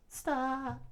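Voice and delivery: very high falsetto plea; small high cry to halt; tearful plea. Acted. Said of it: "broke down into tears".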